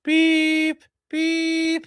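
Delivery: high mock electronic beeps; number station beeps. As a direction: imitate a number station's tones. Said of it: "like one of those number stations".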